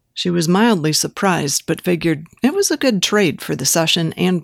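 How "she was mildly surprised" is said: ordinary delivery, nothing marked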